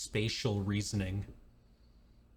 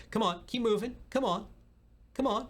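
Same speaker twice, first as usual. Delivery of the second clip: shooing along; 9 semitones higher than usual. Retold or imitated. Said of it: "us shooing a T-Rex away, being like".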